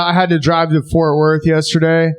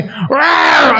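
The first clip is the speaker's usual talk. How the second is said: mock lion roar; 11 semitones higher than usual. Lion grabs Steve.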